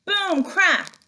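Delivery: high, loud booming crack; dramatic sound effects. A narrator's reading. Teacher reading to class acts out the crash, loud and high.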